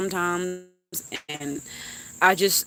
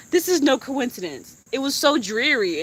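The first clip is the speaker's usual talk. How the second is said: usual speaking voice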